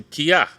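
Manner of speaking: Kia said clearly while pointing; Cree word demo. Shown as a demonstration. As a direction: model Kia for the class to repeat. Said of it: "Point to someone and say".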